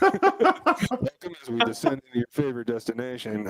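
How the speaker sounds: captain's cabin announcement over the PA; airline pilot voice. Pretend. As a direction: pilot welcoming passengers aboard. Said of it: "Now you sound like an air-, an airline pilot".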